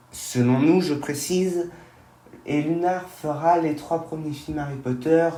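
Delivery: usual speaking voice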